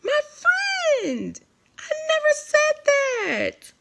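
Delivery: high, slow, drawn-out mock innocence; mocking denial. Mimicking the pastor denying it, sarcastic high drawl. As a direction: stretch it out like a fake denial.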